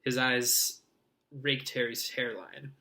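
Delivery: plain reading voice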